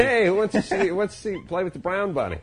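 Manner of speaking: put-on bright high sing-song offer to the kids; hammed-up cheery dad; mock cheery parent. Dad pitching a movie to the twins, mock cheery.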